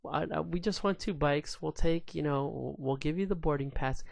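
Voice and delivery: high, rushed backpedal; flustered wife. Retold or imitated. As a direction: scramble to smooth things over. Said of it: "And she's like".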